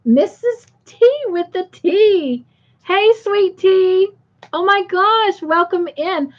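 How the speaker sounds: high, delighted welcome; excited greeting. Real, not acted. Host spots Sweet T arriving in the chat, excited greeting.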